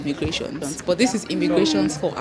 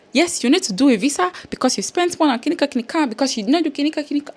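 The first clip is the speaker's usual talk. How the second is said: mock officer; 7 semitones higher, much faster than usual. Voicing the visa officer's demand.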